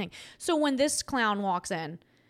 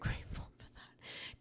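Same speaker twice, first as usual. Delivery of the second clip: whispered; 13 dB quieter than usual. Real, not acted. Whispered with laugh.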